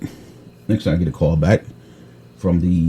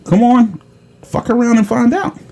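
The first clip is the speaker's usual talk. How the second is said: taunting threat. Retold or imitated. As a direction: egg the scammer on.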